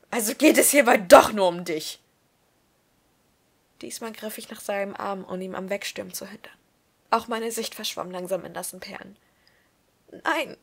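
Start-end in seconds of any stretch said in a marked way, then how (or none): 0.1-2.0 much louder, cutting accusation; bitter reproach. Acted. 10.2-10.6 broken voice. Acted. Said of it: "mit gebrochener Stimme".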